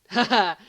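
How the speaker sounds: loud burst of laughter, pleased with the pun; loud laugh at own joke. A narrator's reading.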